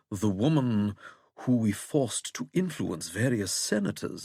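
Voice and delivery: measured plummy English, lifting on openings; plummy English accent. A narrator's reading. Withering recalling how the election was rigged, plummy English accent. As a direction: remind him pointedly in plummy English. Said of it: "in that plummy English accent".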